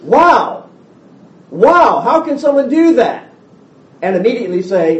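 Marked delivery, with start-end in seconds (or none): 0.0-3.3 high, loud astonished wow; amazed exclaiming. Retold or imitated. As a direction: gasp it out in wonder. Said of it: "it's one thing to say".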